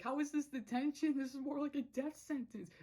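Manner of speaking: high, alarmed complaint; freaking out. Retold or imitated. Luce in the detention pit, high panicked complaint. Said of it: "she's freaking out".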